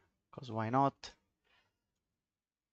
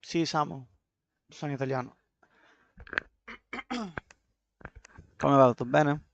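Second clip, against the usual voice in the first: put-on Italian accent; 3 semitones higher, 4 dB louder than usual. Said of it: "don't get too emotional about my accent".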